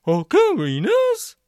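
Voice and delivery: high, loud trombone-man question; a brassy trombone honk of surprise. A narrator's reading. Barnstable hears about the ocarinas in trombone voice. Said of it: "the trombone voice continued".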